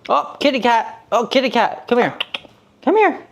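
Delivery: high sing-song call to the cat; high coaxing pet talk. Real, not acted. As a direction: coax the kitty in a high pet voice. Calling the cat in baby voice.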